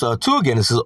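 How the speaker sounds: usual speaking voice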